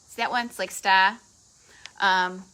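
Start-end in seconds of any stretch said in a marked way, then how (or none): 0.7-1.2 "star" as Boston "stah"; real Boston accent slipping out on "star". Real, not acted. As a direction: drop the R in "star", Boston style. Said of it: "that was my Boston accent coming back".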